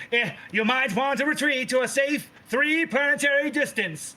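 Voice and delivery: high, strained warning from afar; hammed-up high-pitched dad voice; father calling out. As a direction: call it out like an alarmed dad. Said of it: "You just hear your father say".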